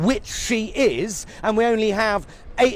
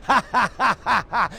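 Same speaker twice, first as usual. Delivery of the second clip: mocking cackle. Mocking protest critics.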